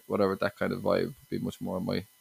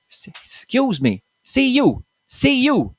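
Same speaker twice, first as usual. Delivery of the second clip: put-on much higher, louder thick Limerick accent calling out; Limerick local; 13 semitones higher, 9 dB louder, much slower than usual. Angry Limerick local calls a confused teen over, loud thick Limerick accent. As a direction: beckon a stranger over in a broad, hammed-up Limerick accent. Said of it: "this local comes up to me and he goes".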